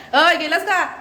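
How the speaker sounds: high laughing shout after him; laughing call-out. Real, not acted. Sister calls after her brother who ran off, laughing shout.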